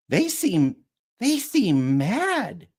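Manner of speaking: high, slow chirped line; chirpy sing-song worry; chirpy Pepper. Acted. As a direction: chirp it out, drawn out and wary. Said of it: "chirps Pepper".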